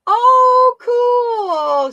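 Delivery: thrilled squeal at the news; delighted squeal. Real, not acted. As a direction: burst out in delight. Excited reaction to chat.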